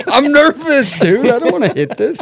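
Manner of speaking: high nervous laughing voice; nervous laughter. Real, not acted. Nervous laughing about salts.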